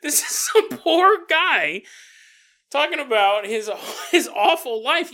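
high, loud, crying whine of a complaint; fake-weep the complaint at the host; mock sobbing. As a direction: listener mock-sobs at the host. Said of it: "As you're sobbing".

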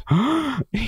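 sharp high gasp; mock gasp of realization. Actress acting out her sudden insight, high gasp. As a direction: gasp as the penny drops.

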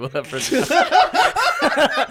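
lightly voiced shady barber's promise; a hint of a sinister pitch line, half laughing; creepy sales pitch. Cab driver lures the new kid in, mock sinister. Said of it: "I don't know why I was about to say".

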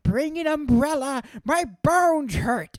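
put-on high, loud old-timer nagging; mock an elder's warning, stretched out; old person. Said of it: "frail bones of an old person".

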